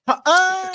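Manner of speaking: high, loud "ah" after a short "ha"; loud yelped laugh leaping up in pitch; punched laugh. Shown as a demonstration. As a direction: laugh cut through by a punch to the stomach. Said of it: "somebody gets, like, punched".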